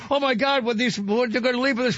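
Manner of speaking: high, fussy bride voice; shocked bride voice. Retold or imitated. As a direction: gasp it out like a worried bride. Bride voiced in wedding story.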